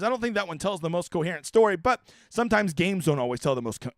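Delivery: normal talking voice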